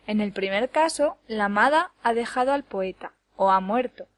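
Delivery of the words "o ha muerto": usual speaking voice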